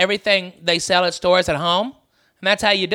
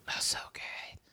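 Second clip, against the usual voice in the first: breathy unvoiced whisper of delight; 23 dB quieter than usual. [name] dreamily imagining the fried treat, whispered. Savouring the idea.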